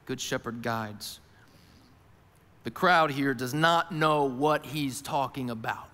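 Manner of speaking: ordinary talk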